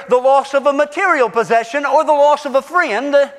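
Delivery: impassioned preacher pressing the point; forceful preaching. Real, not acted.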